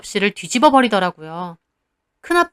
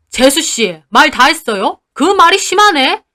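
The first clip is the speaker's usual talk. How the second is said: louder rising angry protest; uncle's angry protest; 4 semitones higher, 5 dB louder than usual. Acted. Uncle rebukes his sister-in-law, angry. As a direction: push back hard. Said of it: "큰아빠도 덩달아 따지고요".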